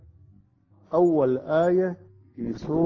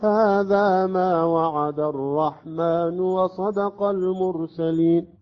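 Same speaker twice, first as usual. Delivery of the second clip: slow, high chanted recitation, louder; Quran recitation; 5 semitones higher, 5 dB louder, much slower than usual. Shown as a demonstration. Teacher recites Ya-Sin verse fifty-two in chant. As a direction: sing the verse in tajweed chant. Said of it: "اثنين وخمسين".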